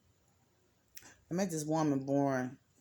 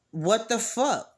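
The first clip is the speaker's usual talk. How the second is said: higher, louder, slowed disbelief; shocked exclamation; 6 semitones higher, 5 dB louder, slower than usual. Retold or imitated. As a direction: draw it out in disgust.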